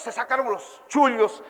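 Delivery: ordinary delivery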